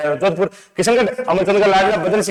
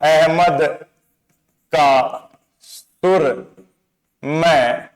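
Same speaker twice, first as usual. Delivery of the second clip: drawn-out, slow, lingering on each word; slow poetic recital; slow mnemonic recital; 4 semitones lower, much slower than usual. Shown as a demonstration. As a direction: recite it slowly, like a line of verse. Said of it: "क्या बात बोली है".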